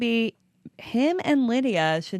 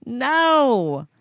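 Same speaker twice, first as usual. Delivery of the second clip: high, loud groan of revulsion easing into a refusal; acted disgust; 7 semitones higher, 4 dB louder than usual. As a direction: recoil in exaggerated disgust. Acts viewers' disgust at the photo.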